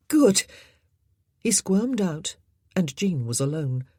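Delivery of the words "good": louder reply. A narrator's reading. Jean answering the boy.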